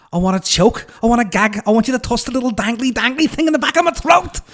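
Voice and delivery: high, loud Scouse rap voice, hammed up; mock Liverpool rapper. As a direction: camp it up as a Liverpool girl rapping. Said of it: "Imagine it would sound something like this".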